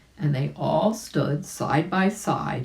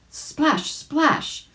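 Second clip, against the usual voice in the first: splash sound effect; 12 semitones higher than usual. A narrator's reading. Narrator voices the splash.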